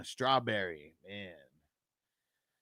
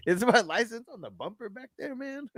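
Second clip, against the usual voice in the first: high, loud quote broken by laughter; can barely get the words out for giggling; laughing through it; 13 semitones higher, 8 dB louder than usual. Retold or imitated. Retelling the stoned driver asking the cop, laughing.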